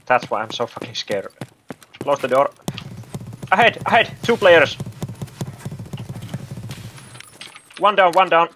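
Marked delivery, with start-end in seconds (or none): 3.5-8.5 loud urgent combat callouts. Real, not acted. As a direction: shout the warning fast and high. Shouting enemy callouts.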